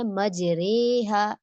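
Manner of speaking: slow मजरेहा, the re between ra and ri; drawn-out मजरेहा with imala; teacher models मजरेहा slowly; imala reading. Shown as a demonstration. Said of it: "वहाँ पे आपने वही करना है".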